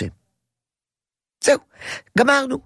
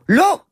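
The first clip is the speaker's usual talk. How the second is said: short firm no, pitched high; one clear final no; 12 semitones higher than usual. Shown as a demonstration. Father tells the toddler no, firmly. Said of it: "ואתה אומר לו".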